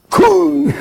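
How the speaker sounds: high, loud, sudden yelp; launch yelp. Retold or imitated. Soul shot down into birth, high loud yelp.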